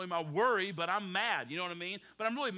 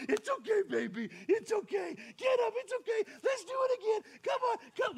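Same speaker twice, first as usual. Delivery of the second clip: encouraging; 10 semitones higher than usual. Retold or imitated. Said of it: "We go".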